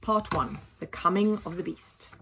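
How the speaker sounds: ordinary delivery, nothing marked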